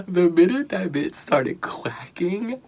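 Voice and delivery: high, airless words through laughter; breathless laughing whisper. Real, not acted. Recapping Morgan's quacking, wheezing with laughter. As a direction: wheeze it out, nearly crying laughing.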